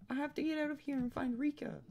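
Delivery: put-on determined high girl. Gamer voicing Hinata vowing to find Rika, high pitched. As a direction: play the girl set on finding her friend, high. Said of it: "I gave her the same voice as Rika".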